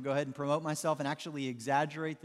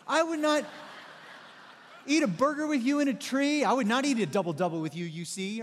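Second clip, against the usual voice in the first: high, loud sing-song Dr. Seuss rhyme; Doctor Seuss rant; 9 semitones higher, 6 dB louder than usual. Retold or imitated. Pastor mocking his roommate in a loud, high Seuss rhyme. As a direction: rant in bouncy rhyme, pitch climbing high. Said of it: "I would just go all Doctor Seuss on him".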